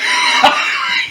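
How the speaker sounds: short breathy laugh out loud; burst of laughter. Real, not acted. Laughing while telling friends the story. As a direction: laugh at the memory.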